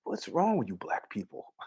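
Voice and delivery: very soft, hushed question; quiet. Retold or imitated. A friend's quiet jab at the speaker. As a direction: drop almost to nothing. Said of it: "he gets quiet and goes".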